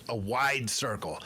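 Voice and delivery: ordinary talk, nothing marked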